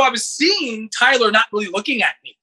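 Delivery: ordinary delivery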